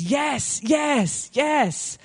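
rising excited yeses; lightly voiced eager man. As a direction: say yes with mounting delight. Eager man saying yes repeatedly.

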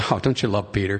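ordinary delivery

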